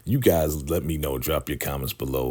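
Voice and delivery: ordinary delivery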